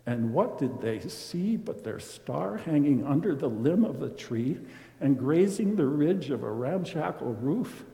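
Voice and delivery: ordinary delivery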